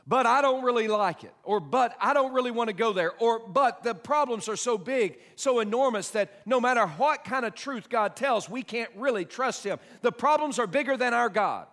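loud, high mocking whine of the doubter; hammed-up excuse, loud and high; mock doubter's protest. Voicing the doubting believer.

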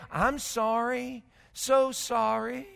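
soft, slow, drawn-out sorry; hushed, lingering mock regret; mock apology. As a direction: imitate someone apologizing meekly, slowly. Mimics someone merely saying sorry.

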